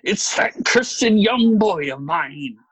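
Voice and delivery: slow, loud, venomous character voice; exasperated father seething at his boy; angry hiss. A narrator's reading. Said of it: "he hissed".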